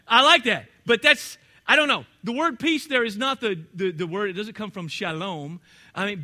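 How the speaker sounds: ordinary talk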